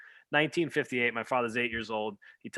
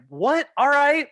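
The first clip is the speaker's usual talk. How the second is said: high, loud baffled squawk; 10 dB louder, slower than usual. Retold or imitated. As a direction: yelp it in disbelief. Son baffled by father.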